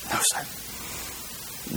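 whispered, out of breath from the swim; breathless no. Acted. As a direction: gasp it out, spent. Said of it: "the guy's breathing hard".

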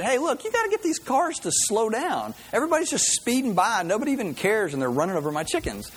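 high, exasperated farmer voice; frustrated farmer. Retold or imitated. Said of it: "and he said".

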